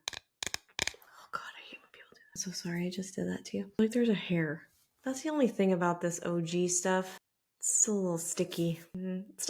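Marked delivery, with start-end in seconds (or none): 0.1-3.7 quick embarrassed whisper. Real, not acted. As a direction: whisper an apology fast. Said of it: "A S M R".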